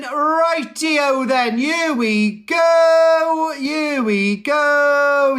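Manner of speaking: slow, stretched, shouted build-up; hype announcement. Acted. Host kicking off the live show, drawn-out hype call. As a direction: drag it out like a showman.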